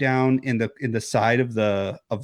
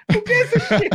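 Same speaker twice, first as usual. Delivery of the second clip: high, laughing squeal of the line; laughing mock line; faster than usual. Mocking a character hit with a wine bottle, squealing through laughter. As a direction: crack up as you mock the character.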